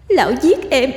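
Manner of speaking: put-on high, echoing girlish ghost voice; pitiful young ghost. Ghost girl accusing her killer, high pitiful voice. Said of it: "Tiếng nói non nớt đáng thương của Hạ".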